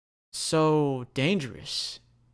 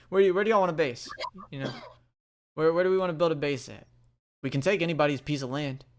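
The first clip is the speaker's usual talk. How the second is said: rushed eager question; 2 semitones higher, faster than usual. Acted. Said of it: "he goes".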